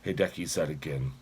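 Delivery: plain reading voice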